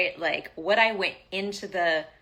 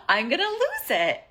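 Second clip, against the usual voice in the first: exasperated outburst; 7 semitones higher, 5 dB louder than usual. Retold or imitated. Venting retold anger.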